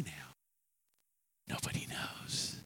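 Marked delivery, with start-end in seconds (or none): 1.5-2.6 hushed conspiratorial whisper; secret whisper. Acted. David whispering to himself about his secret.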